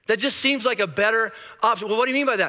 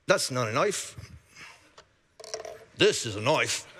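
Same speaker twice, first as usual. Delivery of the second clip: low, quieter Australian movie-tough-guy voice; Australian accent; 8 semitones lower, 5 dB quieter than usual. Shown as a demonstration. Preacher quoting Crocodile Dundee to the congregation, in a low Australian accent. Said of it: "That's my Australian accent right there".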